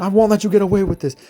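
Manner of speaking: high, loud avenging cry; hammed-up shouted vow; mock indignant threat. Lucius avenging Titus, mock indignant shout.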